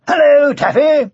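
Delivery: high, loud, bright hail; jolly knight hailing a friend; cheery greeting. A narrator's reading. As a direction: call out a cheerful high hello. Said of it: "said the knight cheerily".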